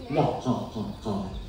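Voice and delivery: low, quiet evil laugh; soft, deep "wah-ha-ha", fading away; villain laugh. A narrator's reading. Eagle leaving with its prize, sinister low laugh.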